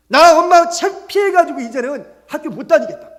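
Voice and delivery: high, loud shouting daughter; acted angry outburst, pitched right up; resentful yelling. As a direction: yell reproaches at your mother, easing slightly toward the end. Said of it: "원망하고 불평하면서 막 소리를 지르는 거예요".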